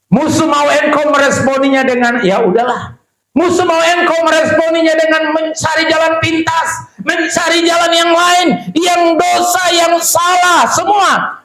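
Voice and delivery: fiery sermon shout; preaching at full force. Acted. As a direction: rouse the congregation from the pulpit.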